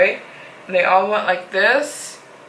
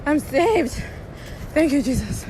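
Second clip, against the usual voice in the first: relieved; 8 semitones higher than usual. Real, not acted. Relieved seeing flashlight.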